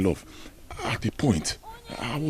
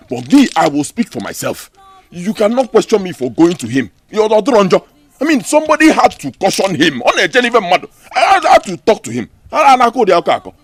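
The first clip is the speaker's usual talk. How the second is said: loud, heated defence; loud, frustrated shouting at his fiancée; angry shouting; 6 semitones higher, 9 dB louder than usual. Acted. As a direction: shout him down in self-defence.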